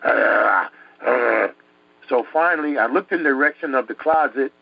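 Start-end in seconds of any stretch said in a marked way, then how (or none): 0.0-1.5 low, rough snore sound; imitated drawn-out snore; imitated snoring noise. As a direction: rumble like a growling sleeper. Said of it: "all I can hear was".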